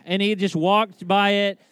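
ordinary talk

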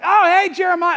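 high, loud, over-friendly hello; mock delighted greeting. Crowd greeting an arriving prophet, mock delighted. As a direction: greet him with hammed-up delight.

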